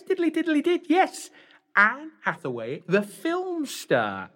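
high, loud, camp panto dame. Acted.

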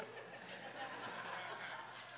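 soft breathy chuckle; quiet laugh. Real, not acted. Preacher chuckling at his own joke about the night, softly.

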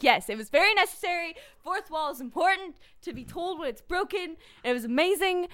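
high-pitched, emphatic mock justification; excited geeky insistence. Answering an annoyed Mama Bear, excitedly insistent. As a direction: insist on it like a delighted nerd.

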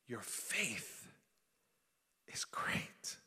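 soft, weighty, whispered reading; hushed pronouncement. Acted. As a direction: drop to a near whisper, with pauses. Jesus' verdict, read in hushed awe.

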